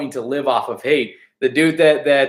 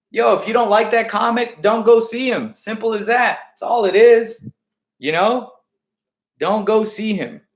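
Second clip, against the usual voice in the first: getting heated; 8 semitones higher than usual. Real, not acted. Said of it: "I'm getting a little heated".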